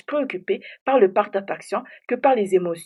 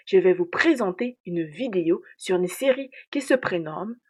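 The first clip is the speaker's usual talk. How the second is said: ordinary talk, nothing marked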